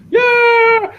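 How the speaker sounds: long, very high loud yell; imitated screaming. Host mimicking how they yell at you, drawn-out scream. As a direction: scream it out, imitating a yelling mob. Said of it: "they're yelling and screaming".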